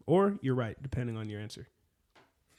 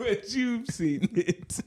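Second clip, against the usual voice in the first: drawn-out echo, pitched way up, rising on "you've"; slow mocking echo; 10 semitones higher, much slower than usual. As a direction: stretch "But you've seen it" high and slow.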